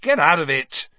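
loud, high cross outburst; angry. A narrator's reading. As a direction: shoo the animal away crossly. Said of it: "shoved Crookshanks angrily away".

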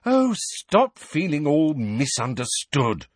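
sharp, high and drawn out. A narrator's reading. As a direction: scold sharply, stretching the words. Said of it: "said Hermione sharply".

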